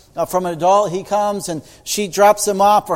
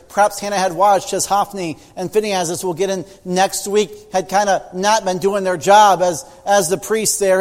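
ordinary talk, nothing marked

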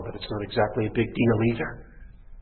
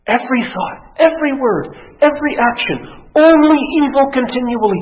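high, loud, mounting list; fervent preacher building to a peak; impassioned preaching; 11 semitones higher, 9 dB louder than usual. Acted. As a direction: hammer each phrase from the pulpit. Preacher at full force.

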